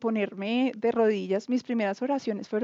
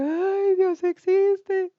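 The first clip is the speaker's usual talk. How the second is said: high, slow, awed cry of discovery; emotional outcry; 8 semitones higher, 4 dB louder, much slower than usual. Retold or imitated. Woman recalling her conversion cries out in awe. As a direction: stretch it out in tearful awe. Crying realizing God exists.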